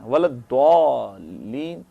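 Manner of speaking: ولد دوالین drawn out slowly with a hard d for the z. Shown as a demonstration. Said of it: "زوات کو دال پڑھتے ہیں".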